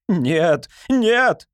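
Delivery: high desperate no; frantic cry. A narrator's reading. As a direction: cry out in panic. Fedor cries out again. (отчаянный крик)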